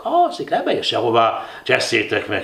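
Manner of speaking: ordinary delivery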